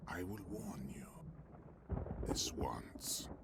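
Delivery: hushed, threatening warning; menacing whisper. Acted. Villain warning his pursuer in a menacing whisper. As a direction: breathe the threat out softly.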